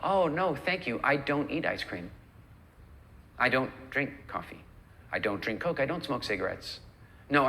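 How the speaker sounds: matter-of-fact, settled no-thank-you; simple, low-key statement of identity; polite firm refusal. Shown as a demonstration. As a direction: decline calmly, leaving no room for persuasion. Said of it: "Do you hear the difference?".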